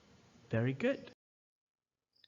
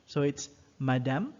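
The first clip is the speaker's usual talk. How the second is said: usual speaking voice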